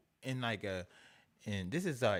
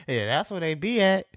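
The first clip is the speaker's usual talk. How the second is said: shouted, higher and louder; loud callout; 7 semitones higher, 10 dB louder than usual. Real, not acted. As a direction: call it out loudly. Shouting repeat of line 82.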